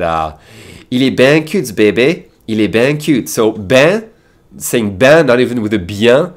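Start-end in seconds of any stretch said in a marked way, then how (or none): 0.9-3.3 quoting Canadian woman. Retold or imitated. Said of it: "saw a baby and said".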